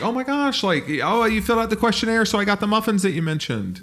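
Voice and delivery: high, excited hostess voice; gushing host mom. Acted. Mom delighted to host her guests, high gushing. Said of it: "your mom would just be having the best time".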